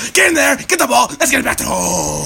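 high, hollered coach pep talk; football coach. Acted. Coach sending players after the ball, hyped holler. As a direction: holler the team onto the field. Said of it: "Any coach, any fucking defensive line coordinator".

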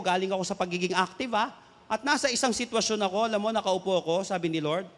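ordinary talk, nothing marked

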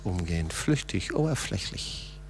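dropped to a whisper. Shown as a demonstration. Pastor echoing "flüchtig, oberflächlich" in a whisper. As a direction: let "oberflächlich" fade into a whisper. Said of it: "mit mir flüchtig und oberflächlich umgehen".

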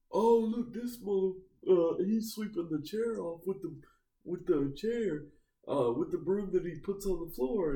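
high-pitched mock outrage; imitated angry complaint. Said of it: "that made him mad".

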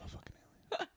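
soft, secretive whisper. Acted. Kurt alone in his most private moments, whispering. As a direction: whisper a private confession to yourself. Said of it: "in his most personal, private moments, he's like".

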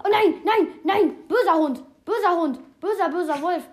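loud, high alarmed scolding; alarmed shout. Real, not acted. Gamer yelling at a wolf attacking his fox, loud alarm. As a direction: yell at the wolf to back off.

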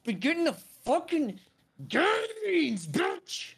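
very high, loud put-on boasting; mock gym brag. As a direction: show off in a silly high voice. Mock boasting about gym workouts.

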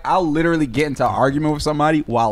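ordinary talk, nothing marked

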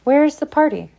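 high, loud, eager question; happily. A narrator's reading. Girl asking her date about the party, happily. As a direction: voice lifts with happy anticipation. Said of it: "she said happily".

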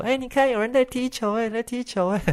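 put-on high excited child voice; imitating kids. Kids in the park spotting the player, high child voice. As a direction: mimic a child pointing something out.

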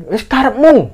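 high, exasperated holler; retold annoyance. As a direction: give up on a stubborn hiker. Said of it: "karena jengkel kan". (menirukan seruan jengkel)

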